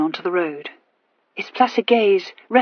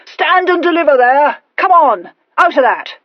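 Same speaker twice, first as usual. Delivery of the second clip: loud, higher mock highwayman bark; young man faking a gruff hold-up shout; put-on gruff shout; 8 semitones higher, 7 dB louder than usual. A narrator's reading. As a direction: bellow like an amateur robber trying to sound rough. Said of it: "in as gruff a voice as he could assume".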